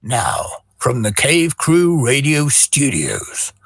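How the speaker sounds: ordinary talk, nothing marked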